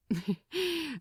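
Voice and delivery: a short, light amused laugh. Acted. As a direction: chuckle fondly before answering. Amused reply on the deck.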